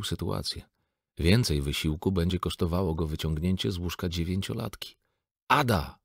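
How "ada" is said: high, loud bellowed name; roar. A narrator's reading. Father roars at his sleeping daughter, loud roar. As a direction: roar her name to wake her. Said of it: "ryknął".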